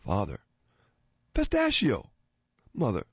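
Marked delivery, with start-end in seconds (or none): 1.3-2.1 high squeaky joke voice. Acted.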